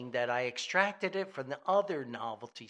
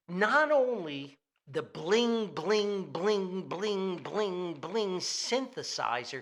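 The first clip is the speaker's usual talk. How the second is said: mock synth bling; 2 semitones higher, 5 dB louder, slower than usual. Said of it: "mimicking the synth line".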